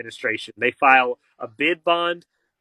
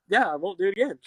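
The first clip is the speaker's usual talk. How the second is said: higher, singsong promise; mock promise; 5 semitones higher, faster than usual. Son mimicking a promise to the judge, mock singsong. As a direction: toss off a fake promise.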